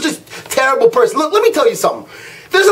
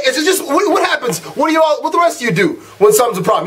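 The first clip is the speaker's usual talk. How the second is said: ordinary talk, nothing marked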